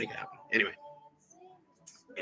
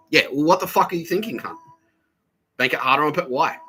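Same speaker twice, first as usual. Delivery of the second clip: angry outburst; 7 semitones higher, 7 dB louder than usual. Retold or imitated.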